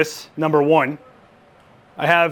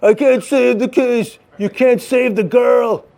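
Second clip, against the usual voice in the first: hammed-up anguished cop, pitched higher; distraught cop; 4 semitones higher, 3 dB louder than usual. Director voicing a cop torn between drug money and partner, mock anguish. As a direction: play a torn cop agonizing over his choice, lightly. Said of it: "a cop that's dis-, just distraught over".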